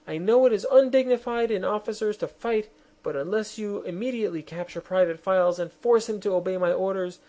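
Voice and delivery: high, pressing royal appeal; queen urging her officers to capture Files; imperious queen. Acted. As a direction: push the officers into action.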